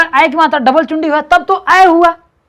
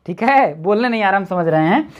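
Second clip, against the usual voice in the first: normal talking voice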